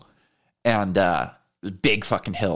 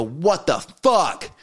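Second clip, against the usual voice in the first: drawn-out, higher-pitched outburst; retold outraged yell; 8 semitones higher, much slower than usual. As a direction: blurt it out slowly and high, indignant. Retells yelling after being run off.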